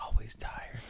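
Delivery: a private murmur of the motto; whispered catchphrase. Acted. Host whispering his motto to himself. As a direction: breathe Always tired in a whisper, no voice.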